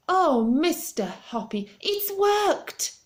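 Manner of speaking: high, loud and slow, overjoyed; crying out excitedly. Acted. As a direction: cry it out in pure joy. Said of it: "she was crying".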